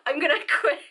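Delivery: giggling, fighting back tears; laughing near tears. Real, not acted. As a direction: laugh it off before the tears come. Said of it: "before I actually start crying".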